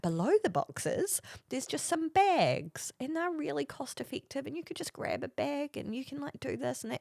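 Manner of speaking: high, soft patient instruction; helpful teacher. Retold or imitated. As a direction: spell it out gently and helpfully. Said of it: "trying to be really helpful".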